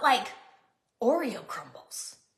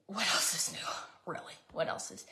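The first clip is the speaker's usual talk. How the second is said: whispered aside; 8 semitones lower, 10 dB quieter than usual. Real, not acted. Nervous cook whispering to herself about making a mess. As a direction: whisper it, low and breathless.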